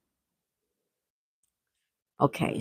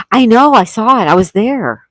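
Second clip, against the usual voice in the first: excited; 4 semitones higher, 10 dB louder than usual. Real, not acted. Excited reply to chat.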